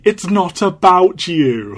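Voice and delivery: mentor's rebuke. Retold or imitated. Quoting the mentor scolding Strange, slow loud rebuke. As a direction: lean on it like a mentor setting him straight. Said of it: "his mentor has to say".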